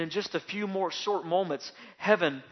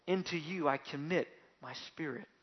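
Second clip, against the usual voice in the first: much softer, surrendered; soft last words; 7 dB quieter than usual. Acted. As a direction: give up the spirit gently. Jesus's last words quoted.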